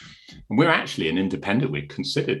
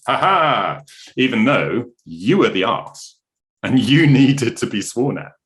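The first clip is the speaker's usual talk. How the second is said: imitating a smug accuser, louder, laughing through it; gloating mock laugh; 4 semitones higher, 7 dB louder than usual. Mimicking a spiteful neighbour gloating, louder and laughing. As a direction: crow over the other person, half-laughing. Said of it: "they're just using it as a weapon and saying".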